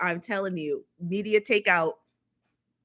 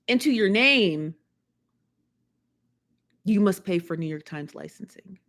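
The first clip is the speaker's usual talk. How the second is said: ordinary talk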